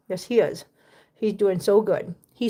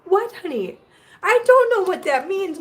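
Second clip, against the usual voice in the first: exasperated pet talk; 8 semitones higher, 3 dB louder than usual. Real, not acted. Owner answering her screaming bird, exasperated pet talk. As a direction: plead in a high coo.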